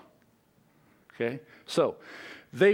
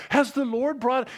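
higher, pointed echo of "Has the Lord brought"; mock piety, higher; 7 semitones higher than usual. Preacher repeats the king's line to mock it, higher. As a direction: jab at it in a higher voice. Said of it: "he's trying to wear this, this garb of piety".

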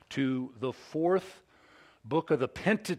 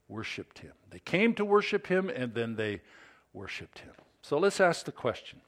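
ordinary talk

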